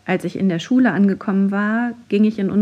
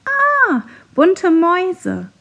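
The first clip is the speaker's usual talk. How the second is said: shrill high loud shriek, drawn out; scream; 11 semitones higher, 6 dB louder, much slower than usual. A narrator's reading. Pupil shrieking at the tiny children in the canteen, scream. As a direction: shriek in fright at tiny mice. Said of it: "Eine Schülerin schrie sogar".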